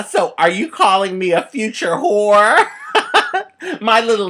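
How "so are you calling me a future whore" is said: loud, high sassy challenge; sassy retort. Pretend. An imagined sassy nine-year-old confronts the fortune teller. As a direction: hand on hip, call her out. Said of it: "hands on my hip".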